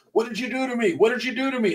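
high-pitched mock panic; retold freak-out. Minister imitates biker fleeing the church, freaking out. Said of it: "he starts freaking out".

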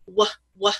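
"w, w" as two short "wuh" sounds; isolated W sound. Shown as a demonstration. Teacher sounding out the start of one, isolated W sounds. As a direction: sound out "w" alone, twice, with a gap. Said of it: "that's the sound that it's making".

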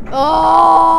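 loud, high, sustained mock scream; long play-acted cry; hammed-up wail of a flung figure; pretend death wail. Nearly dead android flung away.

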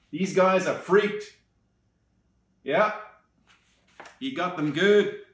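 ordinary delivery

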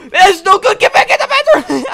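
high, loud frantic shout; panicked yell. Pretend. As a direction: yell a retreat in play panic. Fleeing the monster in game.

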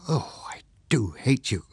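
half-whispered, sighing mock reproach; hushed reproach. A narrator's reading. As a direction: sigh it out almost in a whisper. Wife's murmured mock reproach at bedtime.

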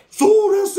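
high, loud mock yell; mimicked fight yell. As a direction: yell it as in a brawl.

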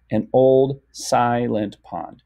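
slow, evenly beaten phrase; measured, as if clapping it out; syllable by syllable. Shown as a demonstration. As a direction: give every syllable its own slow beat. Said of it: "clap to the beat".

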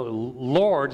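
normal talking voice

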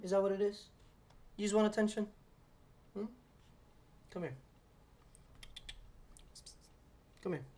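hushed, cooing talk to a pet; soft pet talk. Real, not acted. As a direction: coax a needy cat gently. Talking softly to his cat.

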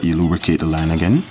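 ordinary delivery

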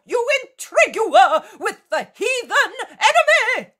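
high, wild heckling with swooping pitch; frenzied hecklers. A narrator's reading. Hostile crowd threatens Jesus with stones, frenzied and high. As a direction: rail at him like a frenzied mob.

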